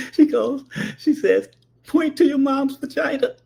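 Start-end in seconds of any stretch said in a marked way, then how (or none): 1.9-3.3 high-voiced mimicry of amused auntie; mock amused command; imitated cracking up. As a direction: pretend to hold back laughter. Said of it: "she's cracking up laughing".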